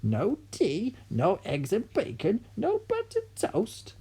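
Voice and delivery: high, slow, whining granny voice; grandma whining about her missing breakfast; grumpy old grandma. Acted.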